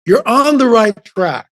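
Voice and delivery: high, slow, drawn-out assurance; imitating encouraging stranger. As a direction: act out a weighty pronouncement, word by word. Voices the stranger's third encouragement.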